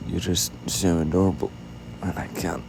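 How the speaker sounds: usual speaking voice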